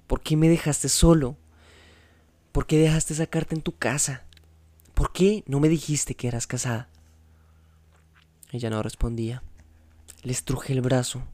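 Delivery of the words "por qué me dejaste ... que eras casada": violent questioning. A narrator's reading. Juan Pablo confronting María, violent and loud. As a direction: hurl the questions at her. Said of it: "pregunté por fin con violencia".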